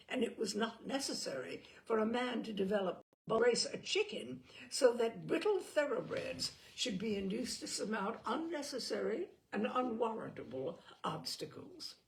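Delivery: usual speaking voice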